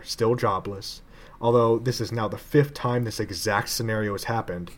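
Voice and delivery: ordinary delivery, nothing marked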